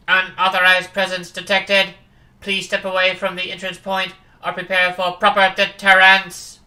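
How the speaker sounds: loud, flat robotic system warning; security computer. Acted. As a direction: bark it like an automated alarm.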